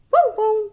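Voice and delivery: high, loud bow bow bark; dog barking. Shown as a demonstration. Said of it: "How does a dog bark?".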